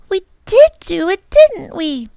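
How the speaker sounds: put-on high, loud hopeful child; light up with pride; brightening girl. Lindy cheering up after the scare, high and hopeful. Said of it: "Lindy brightened a little".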